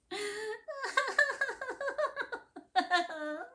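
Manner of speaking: long high rising cat cry; high wailing cat weep. Acted. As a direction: wail like a tiny weeping cat. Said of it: "the very saddest weeping".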